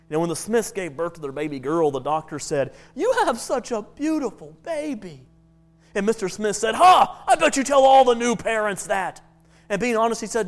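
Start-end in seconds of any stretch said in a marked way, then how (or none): 2.9-5.3 lightly voiced doctor, slow and sweet; a hint of a syrupy doctor. 6.7-9.2 loud, high scoffing Ha; mock scoff of a proud father; scoffing laugh. Joke, father scoffs loudly.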